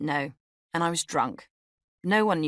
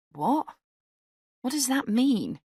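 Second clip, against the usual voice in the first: put-on higher-pitched drunk slur; slurred; 8 semitones higher than usual. A narrator's reading. Drunk narrator questions her date, slurred and puzzled. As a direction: slur it tipsily, pitch sliding up then falling. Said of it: "I slurred".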